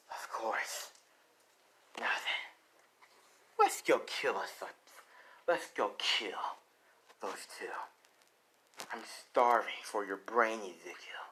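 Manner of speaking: half-whispered, hungry monster; menacing Venom voice. Acted. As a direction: play a predator savouring the kill, trailing into a whisper.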